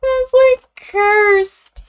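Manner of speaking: high, loud delighted squeal. Real, not acted. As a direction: squeal it out with glee. Amused squeal at drawing.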